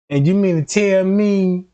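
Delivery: ordinary talk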